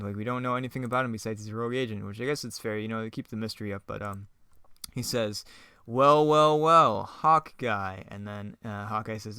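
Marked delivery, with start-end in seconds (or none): 5.9-8.0 loud, drawn-out villain greeting; gloating villain. Acted. Villain meeting the hero in a script read, gloating. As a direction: draw out each "well" to taunt the hero.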